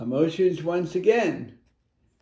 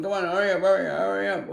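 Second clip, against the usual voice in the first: impatient hurry; 4 semitones higher, faster than usual. Retold or imitated. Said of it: "you could tell them".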